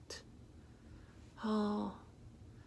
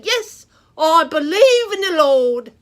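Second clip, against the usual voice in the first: bold declaration; 12 dB louder than usual. Shown as a demonstration. Preacher showing children how to answer, loud bold declaration. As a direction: proclaim it loud and proud, full voice. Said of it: "take a stand and say".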